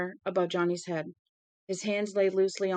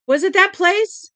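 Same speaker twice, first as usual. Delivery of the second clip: much higher and louder panicked shriek; screaming; 12 semitones higher, 12 dB louder than usual. Acted. Mother realizing her son's party burned, screaming. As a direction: scream it in terror. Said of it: "Shelley Chatsworth screamed".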